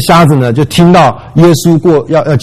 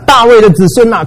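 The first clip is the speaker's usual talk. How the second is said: blind man shouting; 7 semitones higher than usual. Retold or imitated. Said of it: "所以他大声地喊".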